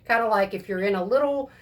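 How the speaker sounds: ordinary talk, nothing marked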